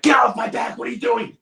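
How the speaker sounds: high-pitched, over-the-top yell; retold yelling. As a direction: snap into a loud, defensive outburst. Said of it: "I yelled at her".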